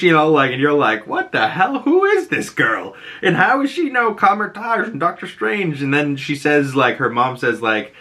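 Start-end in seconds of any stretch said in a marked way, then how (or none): 1.1-5.8 much higher, incredulous what-the-hell; shocked disbelief. Retold or imitated. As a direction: react like you can't believe it. Viewer reaction to Zelma.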